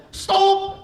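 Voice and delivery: put-on high, loud Confucius accent; mock sage in heavy accent.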